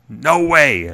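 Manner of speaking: put-on hoarse rasp of a dying old man; raspy voice. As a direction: rasp it out like a frail old father. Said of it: "talking like his father now ... raspy voice".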